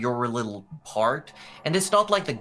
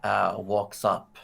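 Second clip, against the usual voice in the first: usual speaking voice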